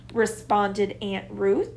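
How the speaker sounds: usual speaking voice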